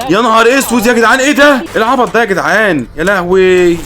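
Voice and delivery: overwhelmed by horror; high frantic dismay; panicked exclamations. Real, not acted. Horrified by many babies.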